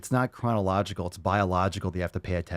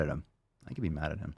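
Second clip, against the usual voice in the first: low and much softer; muttered to self; 7 semitones lower, 12 dB quieter, faster than usual. Real, not acted. Owner steeling himself against the dog, low and soft. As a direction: mutter it to yourself. Trying to be stern.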